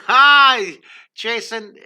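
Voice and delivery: a burst of laughter into a high, loud "Hi"; laughing hello. Real, not acted. Greeting Jason in the chat with a surprised laugh.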